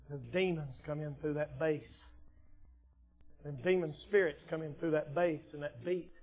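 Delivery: low, soft, grave preaching; quiet, ominous pulpit aside; hushed warning. Real, not acted. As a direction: drop your voice to warn them. Preacher lowers voice about demons.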